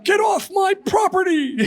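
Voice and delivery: loud, high, drawn-out shout; yelled back. Retold or imitated. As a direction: bellow it like a neighbor chasing off a trespasser. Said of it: "he yelled back".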